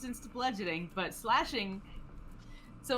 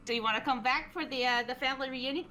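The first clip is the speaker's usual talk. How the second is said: quick, higher-pitched sulky question; pouting; 5 semitones higher, faster than usual. Acted. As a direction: ask hurriedly, still sulking. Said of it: "he's still pouting".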